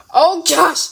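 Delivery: ordinary talk, nothing marked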